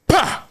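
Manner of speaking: high, sudden pistol pop; sharp vocal imitation of the gun; imitated starting gun. Starter firing to begin the race, gunshot imitation. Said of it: "And then he go".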